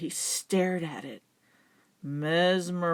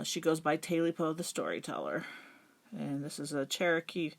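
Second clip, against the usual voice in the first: usual speaking voice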